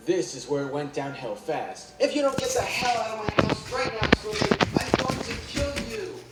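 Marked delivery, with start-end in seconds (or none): 2.0-6.2 high, raging threat in another voice; furious scream. A narrator's reading. SpongeBob screaming at Squidward to get out. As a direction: scream the threat in dead earnest. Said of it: "SpongeBob screams at Squidward".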